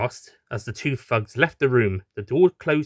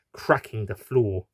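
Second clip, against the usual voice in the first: plain reading voice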